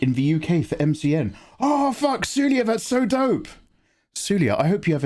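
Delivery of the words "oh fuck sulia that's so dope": excited high. Real, not acted. Excited reaction.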